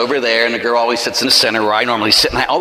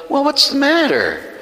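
higher, drawn-out question; concerned pastor; 11 semitones higher, slower than usual. Retold or imitated. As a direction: voice a pastor asking a crying woman. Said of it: "the pastor was greeting people at the door".

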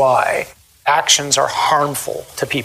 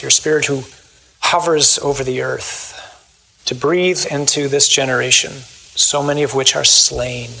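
closing prayer; 4 semitones lower than usual. Acted. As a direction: pray it steadily for the people.

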